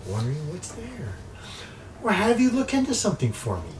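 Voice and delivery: teasing sorceress with high, swooping pitch; imperious crystal-ball mistress. Acted. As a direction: toy with them as a scheming enchantress. Game master plays crystal-ball woman.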